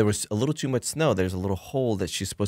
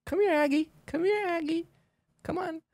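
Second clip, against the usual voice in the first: high calling voice. Retold or imitated. Trainer calling bear.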